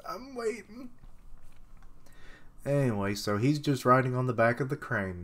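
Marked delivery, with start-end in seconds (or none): 0.1-0.9 tiny, high, soft whimper; mock crying. Crying boy says he's waiting, mock whimper. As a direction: squeak it out as if in tears. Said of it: "it's endearing that he's crying".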